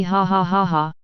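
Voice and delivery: a light, lightly voiced happy laugh. A narrator's reading. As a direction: chuckle it out, pleased with himself. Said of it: "Ye said happily".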